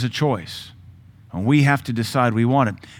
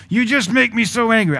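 mock angry accuser; 9 semitones higher than usual. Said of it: "somebody say".

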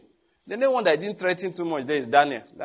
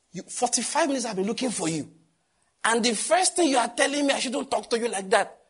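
indignant retort; 7 semitones higher than usual. Retold or imitated. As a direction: protest at the driver, voice climbing. Retold angry reply to driver.

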